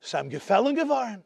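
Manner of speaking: ordinary delivery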